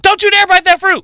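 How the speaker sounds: high, loud scolding warning; scolding shout. Pretend. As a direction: snap it at Eve like a furious mother. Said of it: "slap Eve in her face and be like".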